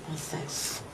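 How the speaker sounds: under-breath whispered nonsense; whispered scoff. Acted. Mother whispering scornfully after scolding her daughter.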